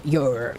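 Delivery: "your" with the final R sounded; "your" held on a rhotic R. Shown as a demonstration. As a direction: pronounce the R at the end of "your". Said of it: "I can say erotic R".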